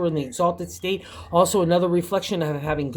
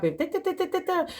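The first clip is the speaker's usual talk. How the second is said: mock meter sound; 12 semitones higher, 4 dB quieter than usual. Imitating a meter ticking.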